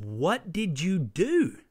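high, slow, outraged demand to the pastors; asking the pastors in disbelief, slow and high outraged; outraged question. Retold or imitated. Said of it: "I was enraged".

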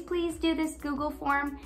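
usual speaking voice

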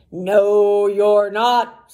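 loud troll refusal, hammed-up. A narrator's reading. Troll refusing the second goat passage, troll voice. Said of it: "said the troll".

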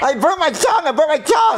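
high wail with a burnt-tongue lisp; lisping in pain. Acted. Boy who burnt his tongue, lisped wailing. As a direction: cry out with the tongue too sore for clear words. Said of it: "dude, you have a lisp".

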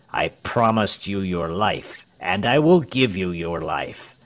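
usual speaking voice

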